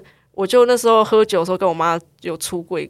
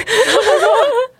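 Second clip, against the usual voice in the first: wheezing high laugh; squealing laughter; high crying laugh; 3 dB louder than usual. Real, not acted. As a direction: laugh till it sounds like crying.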